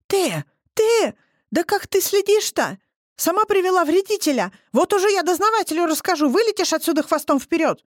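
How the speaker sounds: a furious neighbour raising a row; scandalous yelling. A narrator's reading. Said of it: "Разбудил её скандальный голос". (скандальный крик, намного выше)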